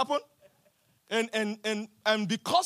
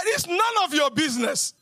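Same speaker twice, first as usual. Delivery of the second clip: loud, high preaching punchline; shouted retort; 5 semitones higher, 4 dB louder than usual. Acted. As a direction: fling it out like a rebuke. Preacher shouts Jesus's retort again.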